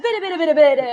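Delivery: high, rapid gibberish scolding; mock angry jabber. Mom storms off jabbering, high mock scolding. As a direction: imitate a mother babbling crossly. Imitating mother's angry chatter.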